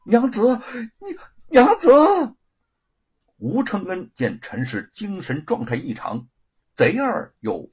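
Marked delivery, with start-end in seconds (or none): 0.0-1.9 loud, high, desperate call; husband crying out after his fleeing wife. A narrator's reading. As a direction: shout after her as she runs off. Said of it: "喊道".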